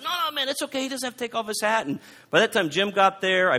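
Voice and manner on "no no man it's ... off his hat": reassuring. Retold or imitated. Calming the angry young man.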